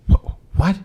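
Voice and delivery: high, loud stammered disbelief; retold astonishment. Imitating a man hearing a dog talk, loud high astonishment. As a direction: act a startled, stuttering double take.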